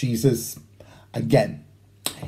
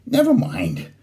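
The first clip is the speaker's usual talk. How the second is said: sighing; 9 semitones higher than usual. Acted. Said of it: "I sighed".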